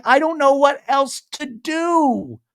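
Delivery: high, drawn-out mock wail; imitated crying. Recapping Taylor crying to her brother, imitated wail. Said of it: "And she's crying".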